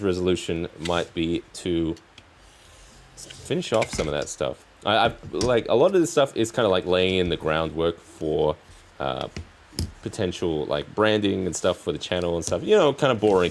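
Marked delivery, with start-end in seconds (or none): none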